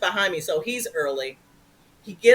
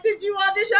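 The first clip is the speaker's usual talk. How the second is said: higher voice; theater actors asking; high curious crowd; 11 semitones higher, faster than usual. Retold or imitated. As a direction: ask eagerly.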